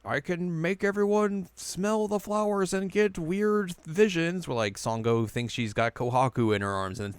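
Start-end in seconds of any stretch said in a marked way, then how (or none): 0.0-4.5 high, drawn-out mock villain voice; hammed-up boast, stretched out slowly; villain's boast. Recapping the villain's flower powers, hammed-up boast.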